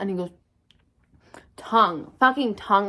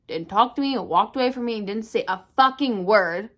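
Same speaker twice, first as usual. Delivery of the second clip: ordinary delivery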